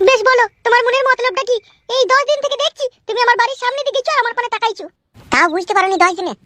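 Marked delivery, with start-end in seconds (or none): 0.1-4.9 high-pitched woman character, questioning; suspicious village woman. Acted. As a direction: confront him in a high woman's voice. Skit, voices the woman being courted. (চড়া মেয়েলি গলায় সন্দেহভরা জেরা)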